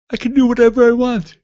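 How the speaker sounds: high, loud cry of freedom; gleeful. Real, not acted. Telling listeners his upcoming free time, gleeful shout. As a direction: crow it like school is out.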